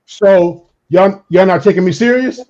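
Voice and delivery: acted heated confrontation; protector voice. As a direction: demand to be taken seriously. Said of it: "That's the protector".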